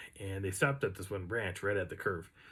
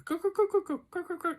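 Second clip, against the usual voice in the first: high, rapid-fire cawing; chattering crow caws; faster than usual. Retold or imitated. Teller imitates the crow's answer, high chattering caws. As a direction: chatter like a crow in quick bursts. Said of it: "like, chattering".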